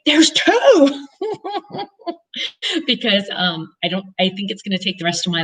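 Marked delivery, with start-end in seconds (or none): 0.0-1.1 high, louder thrilled announcement; gleeful exclaim. Pretend. Promising her sister-in-law, a high gleeful exclaim. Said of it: "I'm gonna say". 1.1-1.8 giddy laugh. Real, not acted. After There's two.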